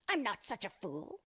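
lightly voiced indignant dwarf; mild scream. A narrator's reading. Dwarf answering Rose Red's question, mildly screamed indignation. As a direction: protest crossly, as a mock scream. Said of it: "screamed the dwarf".

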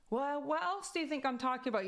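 high, quick mock scolding; granny's loud voice. Granny to confused grandchild in class, mock loud scolding. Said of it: "her voice is loud".

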